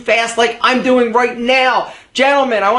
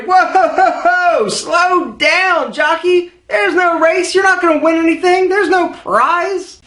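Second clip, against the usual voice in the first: high scolding voice; 6 semitones higher, slower than usual. Acted. Character scolds the fast talker.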